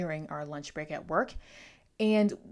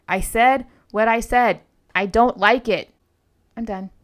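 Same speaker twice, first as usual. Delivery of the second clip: very loud, punched-out declaration; exasperated insistence; 4 semitones higher, 15 dB louder than usual. Real, not acted. Standing her ground against commenters, exasperated. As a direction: lay it down word by word, far louder. Ranting back at critical commenters.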